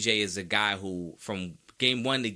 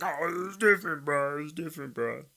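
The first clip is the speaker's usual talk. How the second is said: whiny objector; 3 semitones higher than usual. Acted. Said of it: "Now, you might say, like".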